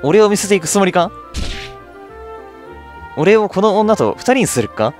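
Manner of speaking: acted indignant protest. Left alone with the woman by Daniel, acted indignant protest. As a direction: protest at being left behind, lightly hammed up.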